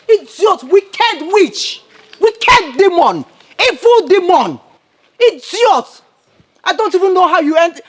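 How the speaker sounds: rapid-fire angry insults; angry cursing. Acted. Cursing an enemy as wicked witch and demon, angry. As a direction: hurl the curses at them.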